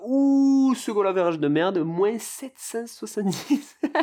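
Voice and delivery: high, loud "ooh" into stunned disbelief; real shock. Real, not acted. Podcaster discovers a terrible goal average, real shocked outburst. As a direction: burst out aghast at an awful figure.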